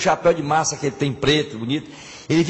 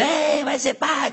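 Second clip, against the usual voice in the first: slow, higher happy call; cheering; 11 semitones higher, much slower than usual. Acted. Husband cheers with friends at the corner, higher slow shout.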